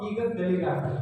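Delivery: ordinary delivery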